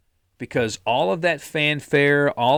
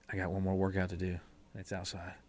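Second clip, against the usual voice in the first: low, hushed dread; reluctant self-talk; 6 semitones lower, 14 dB quieter, faster than usual. Acted. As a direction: grumble it softly to yourself, deflated. Imagined late-night reluctant self-talk.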